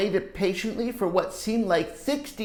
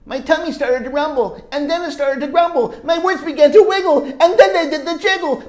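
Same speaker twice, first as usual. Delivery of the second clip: higher and louder, slowing to the burst; building excitement; 10 semitones higher, 13 dB louder than usual. A narrator's reading. Teacher reading, building to the volcano moment. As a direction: climb toward the eruption.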